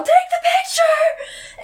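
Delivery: very high, drawn-out shout; hammed-up, loud high-pitched holler; mock frantic yell. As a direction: scream it like a panicked retelling. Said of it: "and they yell".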